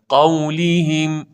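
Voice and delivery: drawn-out قولهم with clear i; model recitation. Shown as a demonstration. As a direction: stretch قولهم as "qawliihim". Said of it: "به این صورت".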